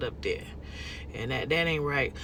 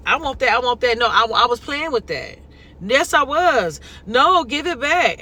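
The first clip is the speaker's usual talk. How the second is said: lightly voiced whining kid, higher and louder; whiny child; 6 semitones higher, 6 dB louder than usual. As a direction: act out a fussing child who wants the toy back. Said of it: "Cry, my mom used to do it".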